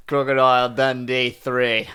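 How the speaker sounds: slow put-on Aussie title read; Australian accent. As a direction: announce "Crocodile Dundee" like an Australian. Film title announced in Australian accent.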